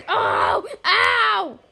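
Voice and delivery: loud, high drawn-out "ow"; pained cry. Pretend. Player hit mid-threat, loud pained cry. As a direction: wail out as the hit lands.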